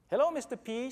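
put-on high, loud child calling out; little girl calling. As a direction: call after a grown-up like a small girl. Little girl greets the narrator.

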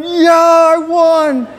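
high, loud victory yell; retold whoop of triumph, held high; triumphant shouting. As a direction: crow it like you just won the fight. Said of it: "And I'm going".

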